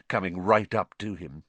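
ordinary delivery, nothing marked

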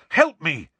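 loud, high plea for help; urgent call. A narrator's reading. Harry flung at Ron while restraining Neville, urgent. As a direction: fling the words out urgently. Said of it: "Harry flung at Ron".

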